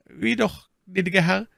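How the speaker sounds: high-pitched incredulous question; astonished exclamation. A narrator's reading. Servant Bazin amazed at the master's earnings, exclaiming high. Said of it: "rief Bazin".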